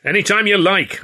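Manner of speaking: high, loud dare; fighting challenge. A narrator's reading. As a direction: square up and dare him loudly. Squaring up to fight him.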